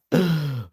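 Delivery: acted startled gasp; mock shocked gasp. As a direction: gasp as if caught out by a prank. Imagines victim's shocked reaction.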